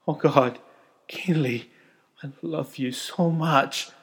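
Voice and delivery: loud, overjoyed cry of love. A narrator's reading. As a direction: burst out with joy, slowing on the last words. Husband overjoyed at pregnancy news.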